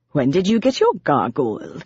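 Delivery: lightly sarcastic British demon. A narrator's reading. Demon to Morgan about Biz, sarcastic. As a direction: needle her in a put-on refined voice. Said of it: "he said sarcastically".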